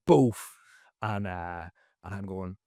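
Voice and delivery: ordinary delivery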